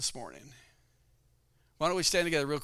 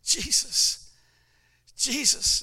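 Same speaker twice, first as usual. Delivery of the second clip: fervent calling; 11 semitones higher, 5 dB louder, much slower than usual. Retold or imitated. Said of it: "I just said".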